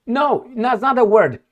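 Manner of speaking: ordinary delivery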